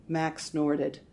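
plain reading voice

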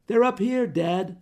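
loud high scream to dad; girl screaming up to her father below. Acted. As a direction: shriek it to carry down. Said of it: "Anna screamed".

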